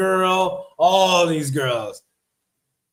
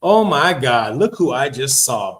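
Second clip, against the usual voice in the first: ordinary talk, nothing marked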